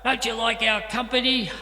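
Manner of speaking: loud, high sneering bully voice; loud guy's taunt. Retold or imitated. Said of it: "That loud guy's going".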